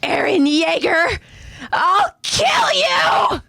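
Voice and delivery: put-on high, slow screaming girl; vengeful yell. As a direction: scream it with revenge. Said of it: "yells out".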